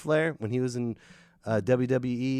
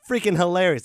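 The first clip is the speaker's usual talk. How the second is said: ordinary delivery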